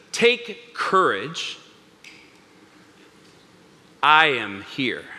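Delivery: very slow, with long pauses; solemn reassurance. Acted. Jesus to frightened disciples, solemn reassurance. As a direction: let each phrase land with weight.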